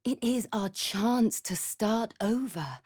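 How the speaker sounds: normal talking voice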